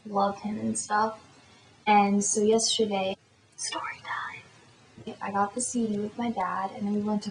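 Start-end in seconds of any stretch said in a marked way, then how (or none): 3.6-4.2 hushed whisper, well under the usual voice; whispered aside. Real, not acted. To viewers before telling the prank story, whispered.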